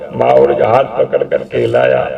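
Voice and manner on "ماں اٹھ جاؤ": the same call dropped much lower; fading call. Acted. Son repeating his plea to his mother, sinking low and hopeless. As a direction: let the call sink as hope fades.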